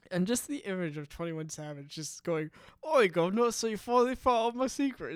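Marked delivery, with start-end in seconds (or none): 2.8-5.2 loud, high mock Cockney geezer, imitated; thick Cockney accent. [name] imagined confessing in a mock Cockney accent. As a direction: ham up a thick Cockney accent for a rapper caught out. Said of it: "like, a really thick Cockney accent".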